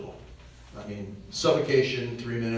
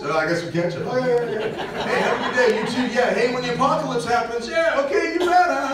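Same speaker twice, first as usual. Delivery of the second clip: grocery store chatter; 6 semitones higher, 3 dB louder than usual. Retold or imitated. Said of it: "and go".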